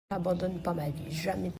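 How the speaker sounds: tender. Real, not acted. Tender promise to dog.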